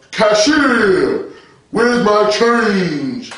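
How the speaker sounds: absurdly drawn-out, mock solemn question; affected deep holy voice. Mock believer addressing a Walmart cashier, affected holy voice. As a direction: intone each word very slowly, pompously. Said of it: "put no deep voice on".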